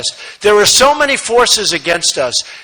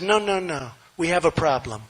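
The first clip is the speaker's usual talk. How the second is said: much softer, calm and gentle; softer, gentler way; 5 semitones lower, 11 dB quieter than usual. Retold or imitated. Father's softer way of refusing, said gently. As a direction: ease it out gently, no edge. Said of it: "he would have said differently".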